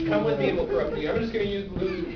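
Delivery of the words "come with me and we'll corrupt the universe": fast repeat. Real, not acted. Host repeats quickly.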